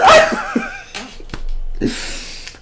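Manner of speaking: very high squealing laughter with a snort. Real, not acted. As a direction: laugh until you snort. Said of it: "I snorted".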